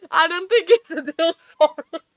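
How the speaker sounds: high, loud, laugh-choked words; laughing apology. Real, not acted. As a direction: struggle to talk through real laughter. Helpless laughter at the cameraman.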